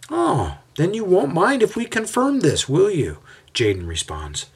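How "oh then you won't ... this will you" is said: much higher, sneering mock courtesy. A narrator's reading. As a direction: fake sweetness with a threat. Mocking reply to Jason.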